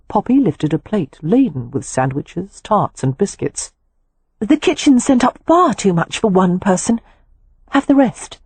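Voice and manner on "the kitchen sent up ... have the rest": Poppy's voice. A narrator's reading.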